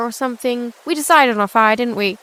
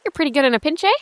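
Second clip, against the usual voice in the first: higher-pitched, joking remark; making light; 6 semitones higher, faster than usual. Acted. Newcomer joking to the healers, light and higher. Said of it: "make a bit of light of it".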